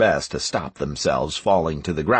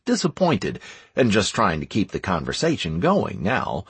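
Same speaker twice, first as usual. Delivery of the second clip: usual speaking voice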